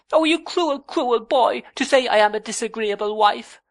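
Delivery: very high, loud wounded cry; cried out. A narrator's reading. Young wife cries out at her husband, very high and loud. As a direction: wail it at him, hurt. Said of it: "cried Dora".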